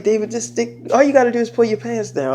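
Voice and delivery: softly imitated come-on; quiet mock pleading; soft coaxing. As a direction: keep it hushed, as if coaxing a partner. Girlfriend coaxing him, retold.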